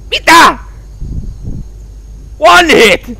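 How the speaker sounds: loud, high, incredulous yelps; outraged disbelief. Real, not acted. Player downed in one hit, loud incredulous shout.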